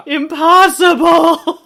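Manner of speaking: drawn-out, high, loud cry of disbelief; hammed-up indignation over a tiny detail; mock outraged shout. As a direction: wail it slowly like a great injustice, half laughing. Retold own outcry at movie error.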